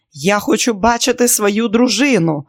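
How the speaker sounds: loud, high pleading demand while struggling; desperate shout. Acted. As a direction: fight to get to her. Struggling husband demands his wife.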